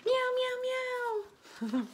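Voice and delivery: very high mock meows; cat meowing. The cat answers the dog, very high meowing. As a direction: mew like a little cat.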